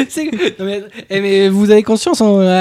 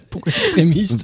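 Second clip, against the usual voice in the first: laughing; 12 semitones higher, 8 dB quieter, slower than usual. Real, not acted. Laughing at odd word choice.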